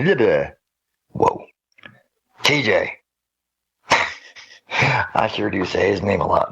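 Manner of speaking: ordinary talk, nothing marked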